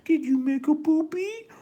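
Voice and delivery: put-on very high, loud cooing baby talk, rising to a squeal; mom baby talk. Video mom cooing to her baby in the sarcophagus. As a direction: gush at the baby like a doting mom. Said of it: "runs the video of mom going".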